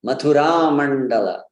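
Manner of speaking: Mathura as ma-thu-RAAA before Mandala; short U, stressed long RA in Mathura; correct long vowel. Shown as a demonstration. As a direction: prolong the last ah of Mathura in Mathura Mandala. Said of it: "Again, the same point".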